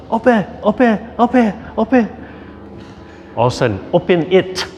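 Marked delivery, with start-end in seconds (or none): 0.1-2.1 put-on high, sing-song little-kid voice; toddler asking. Grandpa voicing toddler's repeated asking, high and sing-song. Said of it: "A couple of days before that was". 3.9-4.7 put-on toddler voice. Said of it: "She said".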